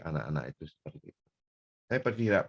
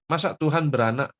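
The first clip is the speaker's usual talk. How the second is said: high, loud mocking question; 6 semitones higher, 5 dB louder than usual. Scoffer ridiculing believers' faith, loud mock disbelief. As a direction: imitate a scoffer's incredulous jeer. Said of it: "mengolok-olok kita".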